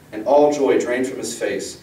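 usual speaking voice

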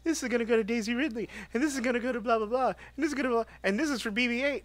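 high, fast, hammed-up ad read; mock commercial voice. Pitching the one-minute Instagram commercial, high ad voice. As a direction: sell it like a goofy promo. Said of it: "a little one-minute commercial".

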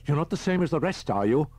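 high, anxious questioning; nervous question. A narrator's reading. Man nervously asks Holman as the car moves off, high anxious voice. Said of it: "the man asked nervously".

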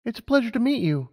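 put-on high, eager young mare; Lumina's voice. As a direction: voice her up high, keen and bright-eyed. Lumina greets Animus.

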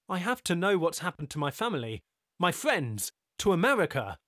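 urgent insistence. Acted.

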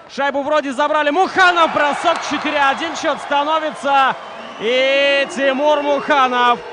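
very loud shouted goal call; excited hockey goal call. Real, not acted. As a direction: scream the goal out. Commentator calls a goal.

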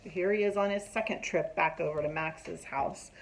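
plain reading voice

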